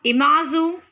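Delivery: "Imazu" in careful French style; French pronunciation. Shown as a demonstration. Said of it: "in English it's image".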